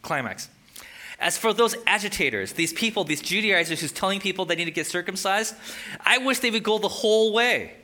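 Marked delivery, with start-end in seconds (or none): 1.2-7.7 reading an angry outburst, raised voice; higher, louder, building to an exasperated wish; Paul's angry passion. Acted. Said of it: "how passionate he is about this".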